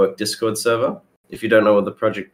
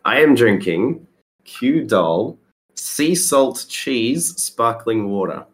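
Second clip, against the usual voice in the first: slow reveal; 2 semitones higher, much slower than usual. Acted. Dramatic reveal of strange drink.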